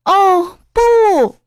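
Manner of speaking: high, loud wail of horror; acted horrified storybook yell; dismayed cry. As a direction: cry out in panic, pitch leaping up. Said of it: "小胡喊了起来".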